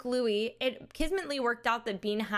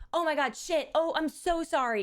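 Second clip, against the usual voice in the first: higher, flustered mock apology; 6 semitones higher than usual. Bean apologizing to the ride attendant, flustered and higher. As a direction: play Bean blurting out an apology. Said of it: "Bean goes".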